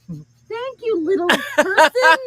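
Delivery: loud, high, drawn-out celebrity impression. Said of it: "and he's like".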